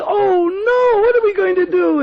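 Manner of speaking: very high, crying alarm; panicked cry. Acted. Radharani fearing Kutila's arrival, panicked. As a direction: wail it in a panic.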